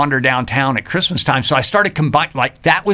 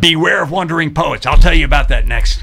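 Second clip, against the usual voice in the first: teaser; 5 semitones higher, 6 dB louder than usual. Real, not acted. Teasing before a radio break.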